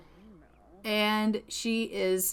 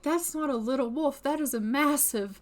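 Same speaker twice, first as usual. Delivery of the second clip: higher, exaggerated indignant fright; alarmed protest; 5 semitones higher than usual. Scared vampire hunter about a wolf, alarmed protest. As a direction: argue back in mild panic.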